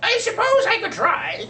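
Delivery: a hesitant seagull squeaking his reluctant offer; squeaky seagull. Acted. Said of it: "said Seagull in his squeaky voice".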